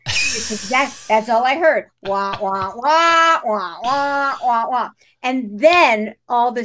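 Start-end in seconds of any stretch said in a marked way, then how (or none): 2.0-4.9 droning muffled trombone wah wah; muffled wah wah talk. Retold or imitated. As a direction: imitate the Peanuts teacher droning. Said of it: "likened him in my book to the Peanuts teacher".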